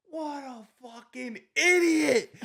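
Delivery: high, slow, exasperated yell. Retold or imitated. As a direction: curse the player for the terrible shot. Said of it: "and I'm like".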